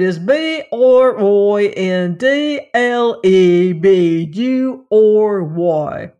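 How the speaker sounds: loud, very slow Cockney spelling; Cockney lad spelling with relish; enthusiastic Cockney. A narrator's reading. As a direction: proudly draw out each letter in Cockney. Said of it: "did not, however, dampen the enthusiasm".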